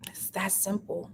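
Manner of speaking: soft whispered close. Real, not acted. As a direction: whisper it simply. Whispered conclusion.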